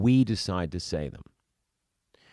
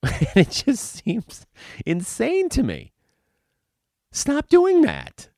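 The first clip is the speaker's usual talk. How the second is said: much higher, loud, slow and incredulous; exasperated; 6 dB louder, slower than usual. Real, not acted. Coach fed up with actors, high exasperated rant. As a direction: throw up your hands at actors, pitched way up.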